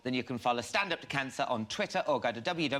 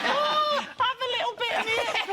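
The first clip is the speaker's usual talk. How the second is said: [name] impression; 3 dB louder than usual. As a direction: ham up a rock star's northern voice. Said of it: "Not sure he talks like that".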